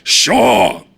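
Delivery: high, loud cheeky shout; shouted cheeky reply; yell back. A narrator's reading. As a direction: toss it back loud and careless. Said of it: "Taihoa yells back".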